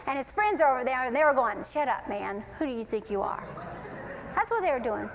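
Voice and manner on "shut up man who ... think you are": low, soft rebuke under the voice; hushed scolding. Acted. Friends hush the blind man, hushed scolding. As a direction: quietly put him down, low.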